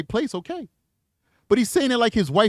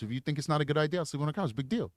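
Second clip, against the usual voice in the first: soft reassurance; 9 dB quieter, faster than usual. Pretend. Gently offering to take couch.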